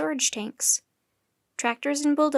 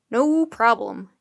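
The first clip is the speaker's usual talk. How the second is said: mock deep croak; 6 dB louder, slower than usual. A narrator's reading. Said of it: "Annie croaked in a really deep voice".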